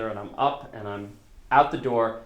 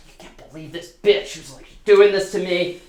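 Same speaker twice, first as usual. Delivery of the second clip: higher, louder agitated rant; upset rant; 7 semitones higher, 9 dB louder than usual. Retold or imitated. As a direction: vent to a friend, worked up. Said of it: "I go".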